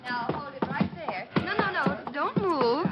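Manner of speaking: bright, commanding pose call; bossy photographer. Acted. Camera girl posing two thugs, bright and bossy. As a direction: freeze them for the shot.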